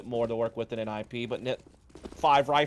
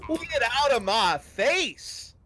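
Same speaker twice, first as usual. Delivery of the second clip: high, slow, loud taunting voice; mock player taunt; 11 semitones higher, 4 dB louder, slower than usual. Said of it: "says Twist".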